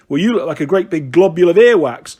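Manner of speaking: loud, mildly mocking boy's jibe; brotherly taunt. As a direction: tease your brother, punching it out. Triplets trading insults in story.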